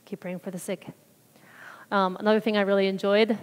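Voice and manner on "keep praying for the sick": soft, low-key own voice; quiet repetition. Real, not acted. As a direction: say it quietly, as a takeaway. Repeats the advice in own voice.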